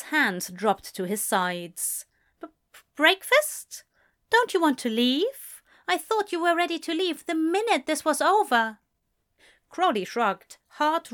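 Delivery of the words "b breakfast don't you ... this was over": surprised stammer. A narrator's reading. Aziraphale thrown by Crowley's breakfast invitation, higher and startled. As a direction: caught off guard, stammering.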